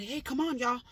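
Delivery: high, loud call back to the bus. Retold or imitated. Calling the passengers off the bus, loud. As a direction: wave them out with your voice.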